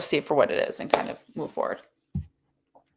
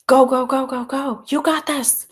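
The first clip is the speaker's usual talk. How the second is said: loud, high cheering; cheering coach; 9 semitones higher, 9 dB louder than usual. Retold or imitated. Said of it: "just like".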